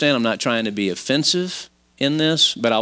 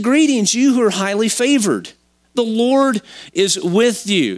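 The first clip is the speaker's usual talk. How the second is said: high, ringing heavenly greeting; proclaiming angel; 8 semitones higher, 3 dB louder than usual. Acted. Angel greeting Mary, proclaiming. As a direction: announce it grandly, pitched well up.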